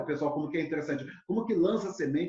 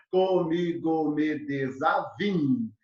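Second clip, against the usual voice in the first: slow, evenly separated syllables; counting syllables; much slower than usual. Shown as a demonstration. Teacher showing seven metrical syllables, slow scansion. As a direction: scan the verse syllable by syllable. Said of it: "olha, sete sílabas métricas".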